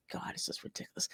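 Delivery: exasperated whisper under a sigh; sighing whispered God; whispered aside. Real, not acted. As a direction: whisper it to yourself, fed up.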